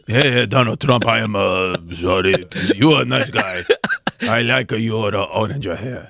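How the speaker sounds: low, halting mock Japanese accent; hammed-up Japanese accent, deep and hesitant; Kim Jong-un, mock Japanese accent. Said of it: "in a Japanese accent".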